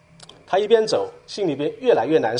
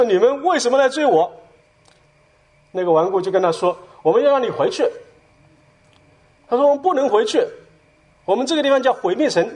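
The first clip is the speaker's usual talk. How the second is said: raised agitated plea; 7 semitones higher than usual. Retold or imitated.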